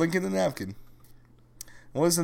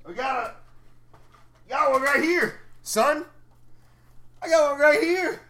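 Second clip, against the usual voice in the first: hammed-up tough guy, higher pitched; mock tough guy boasting, higher; 10 semitones higher than usual. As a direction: play a cocky punk pulling out the goods.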